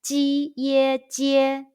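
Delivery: "Ji ye jie" slow, high and level; long, held first tones on "Ji ye jie". Shown as a demonstration. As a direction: model "Ji ye jie" syllable by syllable on a high note. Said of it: "high pitch first tone all together".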